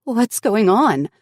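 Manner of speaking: high, loud and slow with fear; frightened. Acted. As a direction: ask in sudden alarm. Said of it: "fear and dread rushed through her veins".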